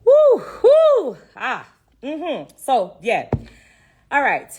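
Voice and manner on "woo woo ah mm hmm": loud, high woo to hold back tears; overwhelmed, whooping to stay composed; emotional outburst. Real, not acted. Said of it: "I don't wanna get emotional".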